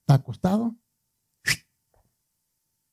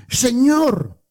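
high-pitched, slow whiny complaint; a sister's pitched-up lament over the smell; Martha complaining; 6 semitones higher, slower than usual. Acted. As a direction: play Martha, fretting and protesting to Jesus. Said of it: "le dijo".

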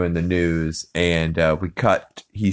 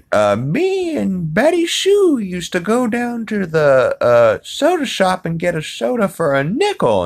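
put-on old lady; 13 semitones higher than usual. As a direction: creak it out like a rambling granny. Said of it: "And she's like".

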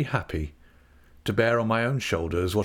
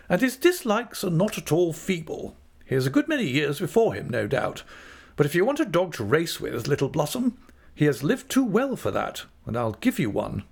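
high, cheery character voice; 7 semitones higher than usual. A narrator's reading. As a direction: chat gaily up high, quickening in places. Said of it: "said my aunt gaily".

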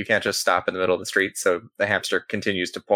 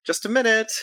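florist calling from back; 13 semitones higher than usual. Acted. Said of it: "you hear someone in the back say".

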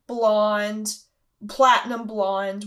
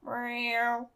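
bored cat meow, softer; Kat the cat; 6 dB quieter than usual. Acted. Cat looking up at its owner, bored soft meow. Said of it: "kind of bored looking".

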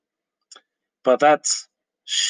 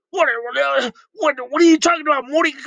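put-on high drunk scientist voice; slurred stammering grandpa; Rick voice; 13 semitones higher, 3 dB louder, much faster than usual. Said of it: "my interpretation of Rick and Morty arguing".

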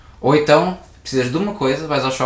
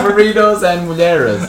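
mock priest; 5 semitones higher, 4 dB louder, slower than usual.

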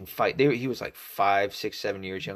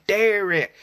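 high, loud imitated holler; mimicked neighbor's shout, pitched way up; neighbor calling out; 11 semitones higher, 8 dB louder than usual. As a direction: yell the name like a Southern mom calling her kid. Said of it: "I remember that lady going".

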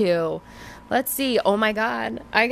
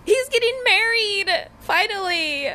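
much higher, thrilled announcement; excited squeal; 13 semitones higher than usual. Real, not acted. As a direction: shriek the good news. Announcing Shane's wedding.

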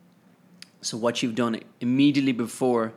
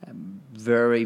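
usual speaking voice

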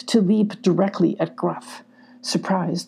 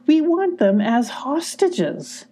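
slow, higher gruff villain line; Gruff's higher voice; 8 semitones higher, slower than usual. A narrator's reading. Gruff scolding his badgers, slow and higher. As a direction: scoff at the idea.

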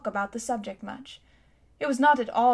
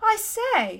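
much higher-pitched startled exclamation; astonished boy; 11 semitones higher, 3 dB louder than usual. A narrator's reading. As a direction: react with a boy's sudden astonishment. Boy shocked to hear of prison.

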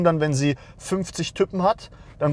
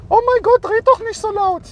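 much higher and louder mock-whiny voice; mocking a whiny critic; 6 dB louder than usual. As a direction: play the offended viewer, squealing at him to pipe down. Mocks critics of his loud rant.

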